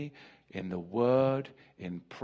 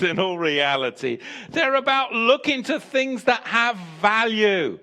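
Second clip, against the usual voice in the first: loud, high, full-force preaching to the congregation; fiery preaching; 7 semitones higher, 10 dB louder than usual. Acted. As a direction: preach it hard, near shouting.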